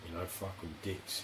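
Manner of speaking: usual speaking voice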